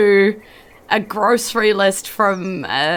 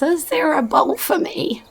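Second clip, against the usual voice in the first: put-on high, creaky old woman's plea; a hungry old woman begging at the fire; 8 semitones higher than usual. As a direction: ask like a hungry old crone. GM voices old woman asking.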